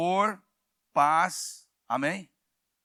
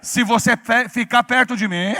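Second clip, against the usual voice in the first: loud preaching call; shouted out loud; 7 dB louder than usual. Shown as a demonstration. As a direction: shout it to the person beside you. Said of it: "Fala bem alto".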